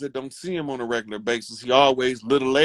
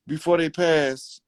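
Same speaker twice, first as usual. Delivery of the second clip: ordinary delivery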